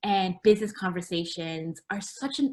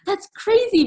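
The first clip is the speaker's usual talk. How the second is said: surprised exclamation; 13 semitones higher, 8 dB louder than usual. Retold or imitated. Retelling her own surprised reaction.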